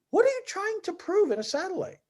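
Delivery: high-pitched retold exasperation; incredulous challenge. Challenging a player's satellite strategy, incredulous. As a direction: push the question up high, as if it's absurd.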